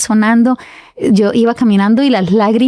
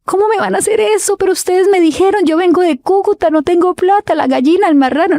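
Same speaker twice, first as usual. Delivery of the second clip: retold crying plea; 9 semitones higher than usual. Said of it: "empiezo a llorar".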